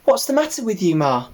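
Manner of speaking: high eager little pig; piglet voice. Acted. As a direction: ask it like a keen young piglet.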